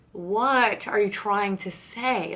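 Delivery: drawn-out, deliberate question; pressing for an answer, very slowly; slow, insistent. Retold or imitated. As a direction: spell it out slowly, word by word.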